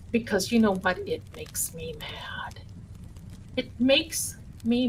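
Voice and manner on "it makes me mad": breathy whispered aside, pitched up. Real, not acted. Streamer whispers her anger to the chat, then repeats it aloud. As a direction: hiss it under your breath.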